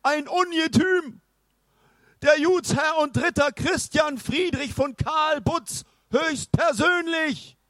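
put-on high, pompous old knight. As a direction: announce yourself like a grand knight. Knight character voice.

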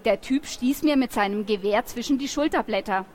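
ordinary delivery

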